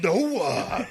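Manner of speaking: high laughing wail of no; high mock no; mock Triple H cry. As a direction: laugh out a whiny no. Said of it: "He's like".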